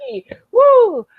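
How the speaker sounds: high, loud hey woo; Flair woo. Retold or imitated. Host finishing the woo exchange, loud high holler.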